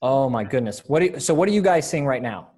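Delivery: usual speaking voice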